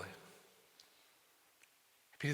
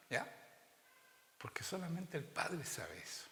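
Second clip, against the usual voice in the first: ordinary talk, nothing marked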